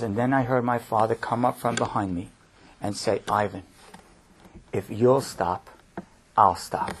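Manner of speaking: soft and low, drawing the listeners in; hushed retelling. Retold or imitated. Father's words in Ivan's retold story.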